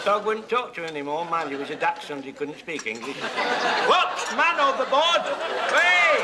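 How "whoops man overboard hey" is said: louder, higher playful cry of alarm; mock alarm shout. Real, not acted. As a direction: call out as if someone fell overboard. Biscuit falls into the tea.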